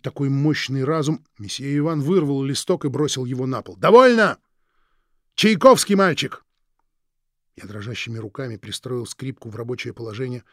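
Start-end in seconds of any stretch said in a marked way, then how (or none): 3.8-6.4 loud angry outburst; angry shout. Acted. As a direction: shout it out in anger. Teacher snaps, throws the sheet. (гневный окрик)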